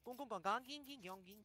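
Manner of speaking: high, sing-song alien gibberish. Pretend. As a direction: babble like a little alien. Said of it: "This is my alien language".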